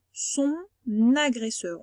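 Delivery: son agresseur, N linked onto agresseur; slow, careful son agresseur; liaison demo. Shown as a demonstration. Teacher modelling the liaison for a pupil, slowly. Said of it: "On dit bien le on".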